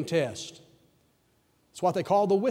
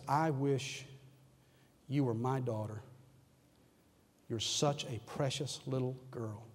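hushed and tender, much softer; softly voiced stage whisper; 5 semitones lower, 11 dB quieter, slower than usual. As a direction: voice it low like a teacher's loving whisper. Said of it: "she whispered in a stage whisper".

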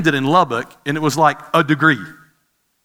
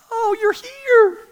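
much higher, slow, delighted squeal; light up as you finally see him; excited; much slower than usual. Retold or imitated. Fiancée finding him really at her door, thrilled. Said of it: "She was so excited".

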